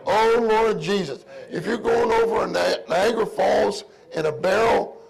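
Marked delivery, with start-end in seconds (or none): 0.1-1.2 fervent cry. Shown as a demonstration. Preacher demonstrating the prayer cry.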